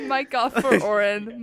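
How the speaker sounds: loud joking order; mock command. Joking call to cut mic.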